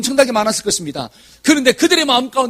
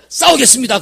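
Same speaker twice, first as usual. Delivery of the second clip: louder, higher burst of resolve; sudden eager proclamation; bold volunteering; 4 semitones higher, 5 dB louder than usual. Retold or imitated. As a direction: declare it boldly to the king. Acting young volunteer before the king.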